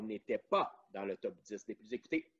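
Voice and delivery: ordinary delivery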